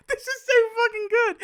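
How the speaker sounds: losing it with glee; laughing hard. Real, not acted. Pleased with the random story, helpless laughter. Delighted by his own plot.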